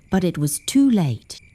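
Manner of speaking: plain reading voice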